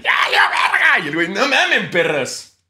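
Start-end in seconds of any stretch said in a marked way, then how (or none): 0.0-1.0 panicked shout. Retold or imitated. Acted panic in skit. 1.3-2.6 hyped vlogger. Retold or imitated. Vlogger voice in skit.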